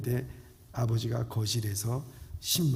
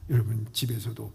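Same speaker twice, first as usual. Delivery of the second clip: ordinary delivery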